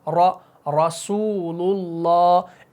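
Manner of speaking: रॉ then रसूलुल्लाह, very slow, र full-mouthed; heavy ra recitation. Shown as a demonstration. Teacher repeating the example slowly with heavy ra. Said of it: "मोटा पढ़ा जाएगा".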